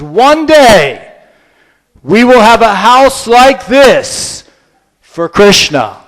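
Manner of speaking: solemn declaration. Acted. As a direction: announce it like a prophecy. Prabhupada points at the mansion.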